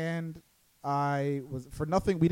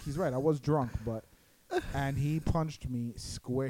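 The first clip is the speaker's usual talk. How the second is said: normal talking voice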